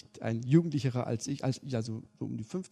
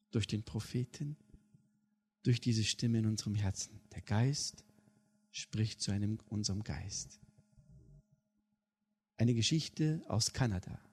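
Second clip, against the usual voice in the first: ordinary talk, nothing marked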